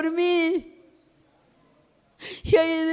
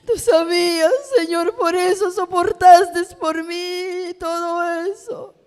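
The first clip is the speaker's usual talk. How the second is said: tearful prayer; 3 semitones higher, 5 dB louder than usual. Retold or imitated. Tearful testimony, retelling prayer to God. (oración entre lágrimas, con voz alzada)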